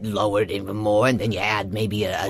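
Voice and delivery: very low New York voice with a speech impediment; low, side-of-the-mouth New York character. Shown as a demonstration. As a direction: talk out of the side of your mouth, deep down. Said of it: "a little speech impediment to it".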